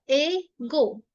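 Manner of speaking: ए and गो said slowly apart; split slowly. Shown as a demonstration. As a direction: separate ए and गो slowly. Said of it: "ए English और गो is language". (ए गो अलग-अलग धीरे)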